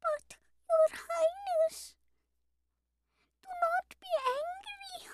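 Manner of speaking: very high, timid little lamb voice; trembling lamb. A narrator's reading. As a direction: plead in a tiny, frightened high voice. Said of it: "replied the trembling lamb".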